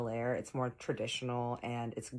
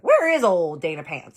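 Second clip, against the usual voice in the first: teasing call-out; 5 semitones higher, 13 dB louder than usual. Retold or imitated. Teasing about his assistant.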